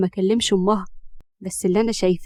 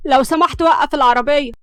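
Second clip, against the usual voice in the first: higher, louder irritated demand; rash, irritated; 4 semitones higher, 6 dB louder than usual. A narrator's reading. Hams demands Siraj stop the car, irritated. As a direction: snap it out on a rash impulse. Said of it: "اتكلمت همس بتهور وعصبية".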